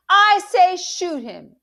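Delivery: slow, high, very loud order; furious insistence. A narrator's reading. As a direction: shout it down, drawing the words out.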